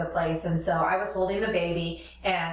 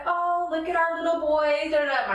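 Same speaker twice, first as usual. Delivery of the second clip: put-on high sing-song coo at a baby; cooing baby talk; 9 semitones higher than usual. Parents cooing at their little boy on video, high baby talk.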